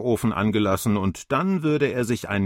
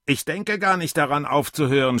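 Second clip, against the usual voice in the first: higher, mock shout at the boy; 6 semitones higher than usual. A narrator's reading. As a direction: yell it defiantly. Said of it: "schrie er dem Jungen entgegen". (dem Jungen entgegengeschrien)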